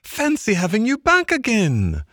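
high-pitched, delighted Mole welcome; beaming delight. A narrator's reading. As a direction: greet an old friend in a high, thrilled voice. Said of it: "his face beaming".

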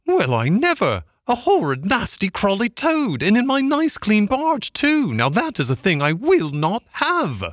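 very high, scandalised woman's voice; outraged barge woman's cry. A narrator's reading. Barge woman finding a Toad aboard, high and outraged. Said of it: "she cried".

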